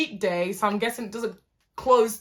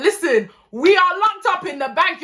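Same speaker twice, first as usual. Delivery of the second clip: raised, louder retold protest; exasperated insistence; 8 semitones higher, 5 dB louder than usual. Telling best friend they're locked in, exasperated. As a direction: spell it out to a disbelieving friend.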